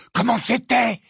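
high-pitched, excited question; eager excitement. Acted. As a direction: demand the juicy details. Ron eagerly asks about the kiss. (question surexcitée, voix aiguë)

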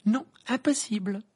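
high, dry refusal; curt. A narrator's reading. Hermione refusing to go with the boys, curt. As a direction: shut him down firmly. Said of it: "répondit sèchement Hermione".

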